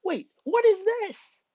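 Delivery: high, loud, drawn-out yell; shocked yell. Acted. Boy sees the floating buildings, shocked high yell. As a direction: yell in disbelief. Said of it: "sees the floating buildings and yells".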